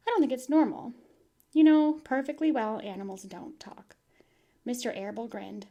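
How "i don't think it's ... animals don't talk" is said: louder, pressing worry; stern reminder to her husband; firm mother. Acted. Mother tells husband animals don't talk, firm pressing worry.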